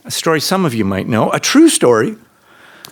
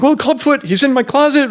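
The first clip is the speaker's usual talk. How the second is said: scared; 13 semitones higher than usual. Retold or imitated. Scared kid telling brother.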